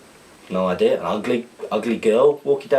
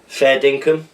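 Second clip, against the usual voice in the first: put-on Australian accent; 5 semitones higher, 5 dB louder, slower than usual. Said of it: "decipher these Australian slang terms slash words in an accent".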